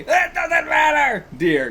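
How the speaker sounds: high, drawn-out shouted outburst; arguing shout. Acted. Acting a character arguing over paying, shouting. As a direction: belt it out high and slow. Voices a quarreling manga couple.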